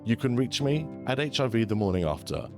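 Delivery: normal talking voice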